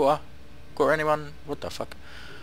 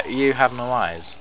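ordinary delivery

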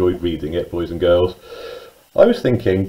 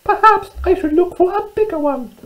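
very high, squeaky mouse voice; little mouse. Acted. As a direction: squeak it out like a tiny storybook mouse. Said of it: "said Little Mouse".

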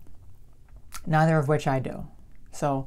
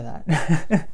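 laughing; 3 semitones higher than usual. Real, not acted. Laughs off the idea.

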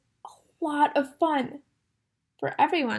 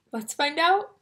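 usual speaking voice

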